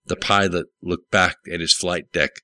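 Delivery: ordinary delivery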